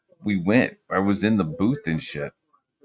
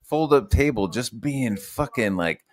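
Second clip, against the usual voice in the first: ordinary talk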